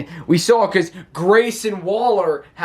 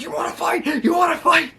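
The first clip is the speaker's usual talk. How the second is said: heated challenge; 11 semitones higher than usual. Retold or imitated. Wrestler squaring up to his partner, heated shouting. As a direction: bark the dare at him twice. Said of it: "He gets all heated".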